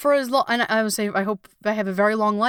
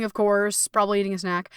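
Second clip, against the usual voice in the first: usual speaking voice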